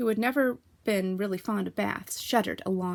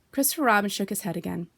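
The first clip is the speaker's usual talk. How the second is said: ordinary delivery, nothing marked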